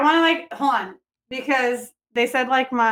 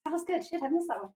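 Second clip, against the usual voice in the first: softer, under-the-breath mock complaint; mock muttered aside; 4 semitones higher, 12 dB quieter, faster than usual. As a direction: grumble it softly to yourself like a missed shot. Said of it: "but you can just say".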